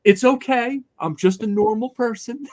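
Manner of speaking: Walter. Retold or imitated. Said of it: "And Walter went".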